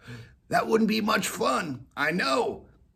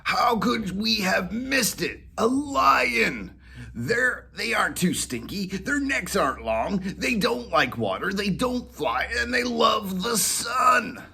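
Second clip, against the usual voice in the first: excited dog voice; 11 semitones higher than usual. Acted. Molly excitedly proposing a lion to Whiskers, higher. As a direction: sell the lion idea.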